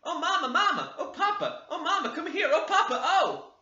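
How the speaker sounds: very high, loud wailing for mamma and papa; children's howling cry. Acted. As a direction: wail like frightened spoiled children. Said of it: "a cry".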